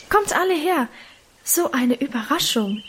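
high-pitched call to gather everyone; excited calling. A narrator's reading. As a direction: announce a surprise find, starting high. Minchen calls everyone to her find.

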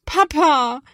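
loud, high, plaintive call; kläglich rufend. A narrator's reading. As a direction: wail for dad, close to tears. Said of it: "klingt es schon etwas kläglicher". (laut und kläglich rufen)

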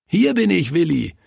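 put-on high child's voice calling back. A narrator's reading. Günther answers Willi across the room, calling. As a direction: call back high and eager to your friend. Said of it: "rief Günther".

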